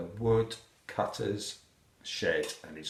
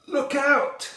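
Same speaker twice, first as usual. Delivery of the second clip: very high, loud alarm call; warning shout; 7 dB louder than usual. A narrator's reading. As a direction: shout it as the hedgehog. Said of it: "shouts the hedgehog".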